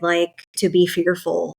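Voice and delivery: ordinary talk, nothing marked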